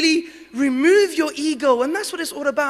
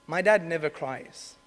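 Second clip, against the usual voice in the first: ordinary talk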